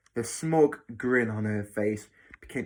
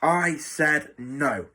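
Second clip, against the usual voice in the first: slow, higher-pitched, emphatic refusal; snapped refusal; 4 semitones higher, 4 dB louder, much slower than usual. Acted. Matron refusing the boy's plea, snapping. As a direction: stress each word to shut him down. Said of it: "snapped Matron".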